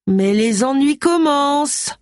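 high, slow, drawn-out exclamation; exclaimed warning. A narrator's reading. Warning the boss of trouble arriving, exclaimed.

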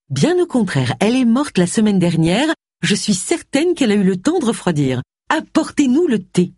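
high-pitched, tart lady of the house; retort, then order imperiously; Cassandra. A narrator's reading. Cassandra overrules the scolding housekeeper, tart.

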